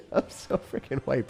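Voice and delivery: laughing through a drawn-out remark; real laughing. Real, not acted. Streamer telling chat about being called mixed, laughing. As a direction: crack up while saying it.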